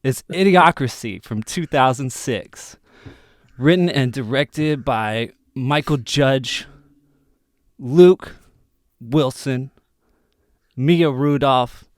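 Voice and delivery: high, loud, halting slacker voice; Justin Long impression. Podcast host introducing the film as a celebrity impression. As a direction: pitch it up and drag out the names, like a dazed dude. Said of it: "that was my best Justin Long impression".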